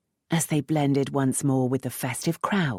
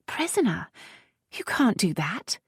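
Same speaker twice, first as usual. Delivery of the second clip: startled protest; 8 semitones higher than usual. Acted. Captive protests being held.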